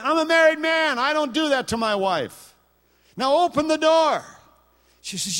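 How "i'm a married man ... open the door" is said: loud, high indignant refusal; indignant demand. Retold or imitated. Preacher retelling how he demanded the locked door be opened, loud. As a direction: insist hard, you're offended. He insists to landlady.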